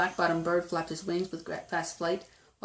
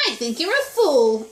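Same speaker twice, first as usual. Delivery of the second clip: scornful kangaroo, higher and louder; mock him with sour amusement; loud, raised-pitch jeer; sour mocking laugh; 5 semitones higher, 7 dB louder than usual. A narrator's reading. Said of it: "laughed the sour kangaroo".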